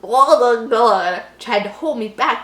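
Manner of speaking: ordinary delivery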